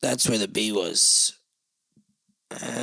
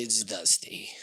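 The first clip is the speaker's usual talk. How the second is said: whisper; 4 semitones lower, 6 dB quieter, slower than usual. Real, not acted. Repeated in a whisper.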